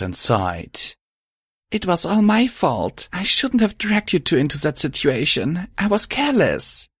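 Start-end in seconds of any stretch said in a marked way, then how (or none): 1.7-6.9 high-pitched, upset self-blame; tearful confession, acted; sniffling guilt. A narrator's reading. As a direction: blame yourself in a high, pained voice.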